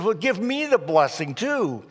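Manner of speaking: angry Esau. Retold or imitated. Cheated son demanding from his father, angry. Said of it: "Esau is so angry".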